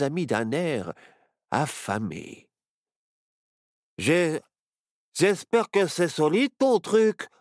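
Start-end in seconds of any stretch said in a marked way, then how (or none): 4.0-7.3 falter, then blurt out the worry; nervous stammer. A narrator's reading. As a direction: stumble on the first word, higher. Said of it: "bredouilla Barnabé". (bredouillement inquiet)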